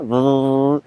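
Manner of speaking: "the" as a long buzzing th, tongue between teeth; drawn-out th exercise. Shown as a demonstration. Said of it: "vous faites ça". (« the » en th vibré et allongé)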